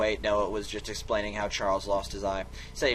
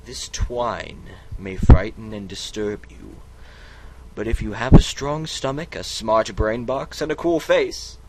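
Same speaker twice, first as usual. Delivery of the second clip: grand dramatic narration; 8 dB louder than usual. Acted. Narrator finally delivers the spooky warning, loud and grand. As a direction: announce it like an epic trailer.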